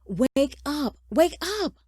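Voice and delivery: high, loud, insistent call; urgent wake-up shout. A narrator's reading. Son shaking his father awake, urgent high shout.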